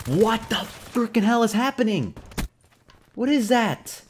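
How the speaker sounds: high, loud disbelief; real exasperated yelling; incredulous outburst. Real, not acted. Bewildered by what kills them.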